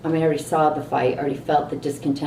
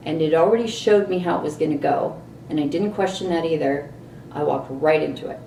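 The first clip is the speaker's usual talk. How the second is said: ordinary talk, nothing marked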